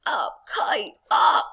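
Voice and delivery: high, loud storybook yell; yelled command. Acted. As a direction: punch each word, last loudest. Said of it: "and he yells".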